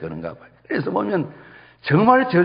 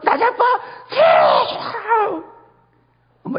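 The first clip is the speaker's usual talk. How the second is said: shrieking high curse, half laughing; mock curse shout. Preacher imitating a mother cursing her children, laughing shout. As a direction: fling out the curse in a laughing yell. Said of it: "이렇게 욕을 해요".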